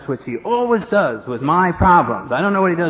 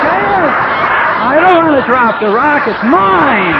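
loud, high stubborn protest; cling on and shout back no; defiant refusal; 8 semitones higher, 6 dB louder, slower than usual. Retold or imitated. The speaker refusing to give up his rock, loud defiant shout. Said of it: "And I'm like".

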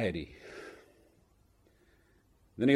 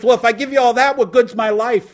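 put-on much higher, louder panicked drunk; backpedaling drunk; 9 semitones higher, 8 dB louder than usual. Drunk trying to back out of the deal, high and alarmed. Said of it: "He says".

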